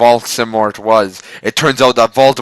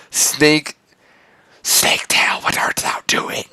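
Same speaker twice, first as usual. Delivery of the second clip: creepy whisper; unvoiced villain whisper; 5 semitones higher, slower than usual. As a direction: hiss it from the shadows. Villain voiced.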